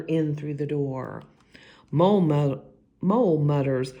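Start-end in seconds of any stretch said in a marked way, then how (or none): none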